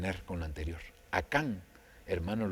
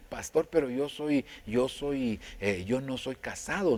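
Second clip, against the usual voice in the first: a higher, louder mock protest from the congregation; objecting listener; 4 semitones higher, 5 dB louder than usual. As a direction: play the doubter who protests.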